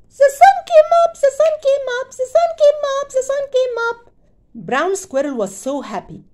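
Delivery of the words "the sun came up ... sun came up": squeaky very high chirp. Acted. Happy squirrel chirping at sunrise, squeaky high. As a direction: chirp it with glee. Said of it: "he chirped".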